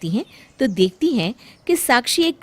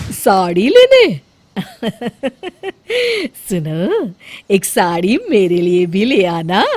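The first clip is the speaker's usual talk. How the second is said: put-on sugary old mother-in-law asking a favour; sweetly, mother-in-law; 5 dB louder than usual. Mother-in-law sweetly asks for a sari too. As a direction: coax the bahu with fake sweetness. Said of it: "प्यार से वो साक्षी को कहती है".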